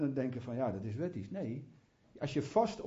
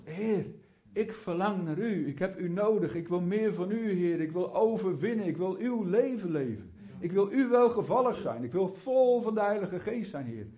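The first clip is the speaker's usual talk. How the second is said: rising, louder pleading prayer to the Lord; fervent prayer; 4 semitones higher, 4 dB louder than usual. Retold or imitated. Preacher praying aloud to God, fervent and pleading. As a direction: pour out longing, voice climbing.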